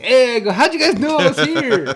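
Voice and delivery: high, loud happy yell; excited shout. Retold or imitated. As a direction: burst in shouting with joy. Bursting through the door happily.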